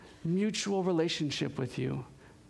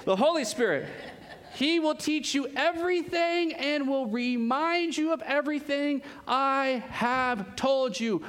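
higher, slower, ringing out each word; excited proclamation; 7 semitones higher than usual. Shown as a demonstration. Preacher reading John fourteen six to the church, excited and slow. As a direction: preach it with a big lift of joy. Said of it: "woo, baby".

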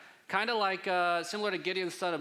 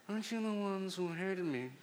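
Italian mobster voice; 3 semitones higher than usual. Pretend. Preacher jokily rereads Jephthah's challenge, mock Italian mobster voice. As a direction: put on a mock Italian gangster accent. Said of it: "in an Italian mobster voice".

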